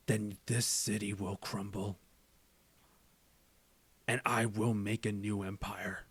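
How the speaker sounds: low, hushed crime-boss menace; Kingpin. Acted. Crime boss vows the city will crumble, cold quiet menace. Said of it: "say it in my Kingpin voice".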